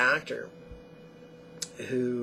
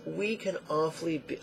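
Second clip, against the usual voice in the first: ordinary talk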